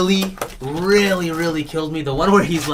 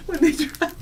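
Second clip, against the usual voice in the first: laughing; 11 semitones higher than usual. Real, not acted. Laughing as he starts the bit.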